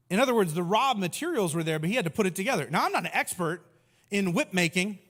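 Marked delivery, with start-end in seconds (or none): none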